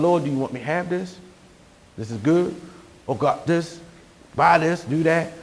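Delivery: hushed, quick asking of God; quick quiet shopping prayer. Retold or imitated. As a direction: murmur it fast and low, like praying under your breath. Prays before buying something.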